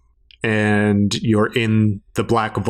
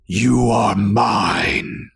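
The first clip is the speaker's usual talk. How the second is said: slow, looming voice in the head; booming echoing villain monologue; much slower than usual. Acted. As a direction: speak slowly like an ancient presence. Entity speaks in her head.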